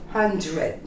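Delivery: Hundred with a soft flapped d; hundred demo. Shown as a demonstration. Teacher models Hundred for learners, soft d. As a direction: say Hundred slowly, d barely there. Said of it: "it almost becomes a very subtle".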